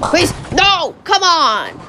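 high-pitched, pleading cry at a bad shot; real exasperated plea; frustrated outburst. Real, not acted. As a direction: protest the miss, high and exasperated. Player loses a game shot.

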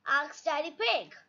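plain reading voice

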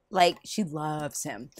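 ordinary talk

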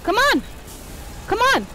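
frustrated shouting. Real, not acted. Player desperate for the game to respond, frustrated shouting. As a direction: urge it on with each yell.

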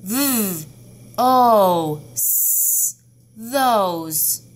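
segment and blend those; teacher modelling sounds of those; sounding out. Shown as a demonstration. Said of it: "Tell me the sounds of those".

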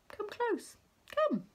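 high, coaxing crab voice; luring crab. A narrator's reading. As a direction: lure the fish in sweetly.

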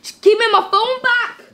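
heated shout for what is yours; angry demand. Acted. Demanding his stolen phone back.

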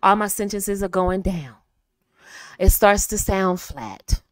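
"down" and "flat" dropping at the end; dull, falling endings on DOWN and FLAT; sentences going down. Shown as a demonstration. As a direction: let the pitch sink onto "down" and "flat". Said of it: "See how I did that?".

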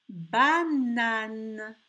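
"Banane" loud, each syllable stretched; teacher sounding out "Banane"; word sounded out. Shown as a demonstration. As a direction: project "Banane" slowly with long n sounds. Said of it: "il y a deux fois le son N".

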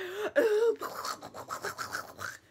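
loud, high gasp into sputtering mouth noise; gasp, then sputter a long mock burst of shots; imitated gunfire noise. Reader mimicking Humbert shooting, sputtered gunfire noise.

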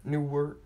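ordinary talk

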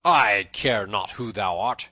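slow, loud defiance; bold. A narrator's reading. Tanner brushes off the forester's threat, loud and bold. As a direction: hurl it back, unimpressed. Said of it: "answered the bold tanner".

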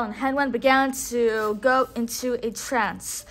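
plain reading voice

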